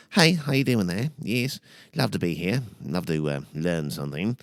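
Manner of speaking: put-on British greeting; British accent. Shown as a demonstration. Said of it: "different variations of, uh, British accents".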